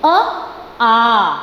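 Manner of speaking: अ clipped short, आ stretched out long; short then long vowel. Shown as a demonstration. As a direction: contrast अ and आ by length. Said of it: "comparison कीजिए".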